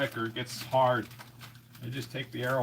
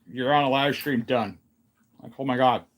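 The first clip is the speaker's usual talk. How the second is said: ordinary delivery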